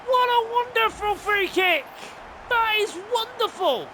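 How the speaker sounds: high-pitched, marvelling at the goal; elated praise. Real, not acted. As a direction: gush over a wonder strike. Admiring his own free kick.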